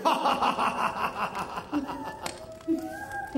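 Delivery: long staccato stage laugh; mocking laugh. Shown as a demonstration. Attendants told to laugh at the officials, mocking laugh. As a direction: laugh at them in exaggerated ha-ha bursts. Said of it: "Start laughing at them".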